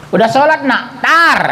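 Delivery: high sharp scolding burst, like a firecracker; nagging mother yell. Retold or imitated. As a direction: fire it off at the kid. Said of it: "Kayak petasan".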